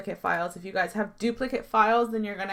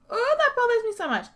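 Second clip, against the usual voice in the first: annoyed; 13 semitones higher, 4 dB louder than usual. Real, not acted. Annoyed at hair glitch.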